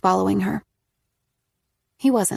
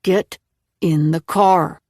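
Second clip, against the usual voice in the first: demanding; 2 semitones lower, 4 dB louder, slower than usual. A narrator's reading. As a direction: give a slow firm command. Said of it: "Nick demanded".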